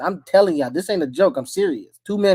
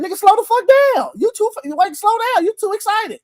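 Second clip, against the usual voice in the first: mock wife voice; 10 semitones higher than usual. Quoting his wife mid-sex, high mock scold. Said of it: "She was like".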